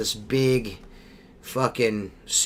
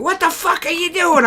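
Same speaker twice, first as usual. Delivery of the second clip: high, loud furious yell at him; angry shriek; 5 dB louder than usual. Retold or imitated. Wife yelling at her drunk husband, high furious shriek. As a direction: screech it like a woman woken soaked. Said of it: "Jennifer slapping the shit out of me".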